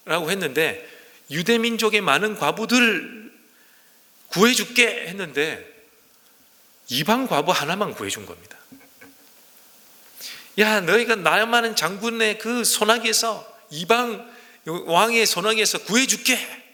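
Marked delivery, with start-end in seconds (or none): 1.3-5.1 high, ringing mock pledge; hammed-up promising voice, higher; mock grand promise. Mocking the expected grand promise. 10.6-16.6 high, slow, ringing mock pledge; mock grand promise. Mocking the expected rescue promise.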